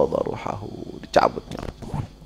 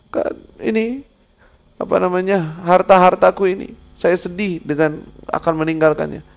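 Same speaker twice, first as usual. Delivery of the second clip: high, whiny reply about his treasures; sad rich man; 7 semitones higher than usual. Retold or imitated. Rich man answering the angel, high and whiny. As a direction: plead that he hates to leave his wealth.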